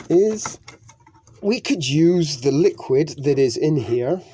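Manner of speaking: effortful, pushing the words out; straining with a stuck can. Real, not acted. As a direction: talk while forcing the lid open. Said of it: "I don't know why I can't get the lid off".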